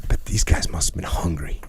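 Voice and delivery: unvoiced stage whisper. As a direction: whisper it like a secret joke. Joking aside to cohost.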